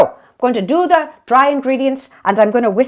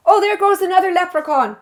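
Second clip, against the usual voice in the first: high, startled pretend sighting; mock surprised spotting; 8 semitones higher than usual. Cook pointing out a pretend leprechaun, mock surprised. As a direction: point it out like a leprechaun just ran by.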